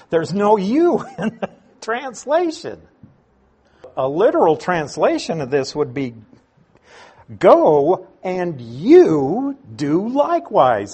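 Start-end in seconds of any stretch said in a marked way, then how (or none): 0.1-2.8 higher, laughing through the words; amused laughter. Real, not acted. Preacher pointing out a translation error, amused laughter. 7.4-10.9 slow, loud command with "you" punched; emphatic YOU. Shown as a demonstration. Preacher giving the literal translation of Jesus' command, emphatic "you". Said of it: "it's a very emphatic you".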